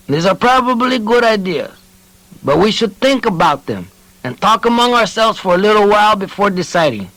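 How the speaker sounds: high, measured counsel; cautious old man. A narrator's reading. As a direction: urge patience. Tranquilinos urges caution.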